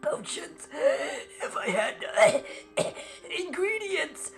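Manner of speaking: very high, quavering with coughing fits; high crackly old man, coughing; doddering old alchemist. Acted. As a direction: wheeze out a welcome like an ancient alchemist.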